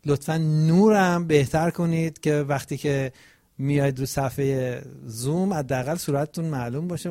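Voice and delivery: ordinary talk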